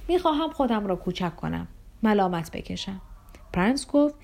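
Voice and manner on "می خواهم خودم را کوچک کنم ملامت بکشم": ordinary delivery, nothing marked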